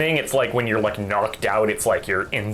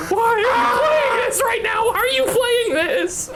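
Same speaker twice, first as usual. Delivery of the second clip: panicked laughing shout. Retold or imitated. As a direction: shriek it while cracking up. Retelling panic at scary song.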